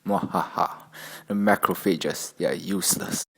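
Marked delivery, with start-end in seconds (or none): 0.1-0.7 villain laugh. Acted.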